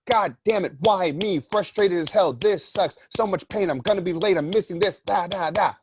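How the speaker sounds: mock frustrated rant at a flat tire; frustrated driver. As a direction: vent like a stranded driver slamming the wheel. Second driver voiced in story.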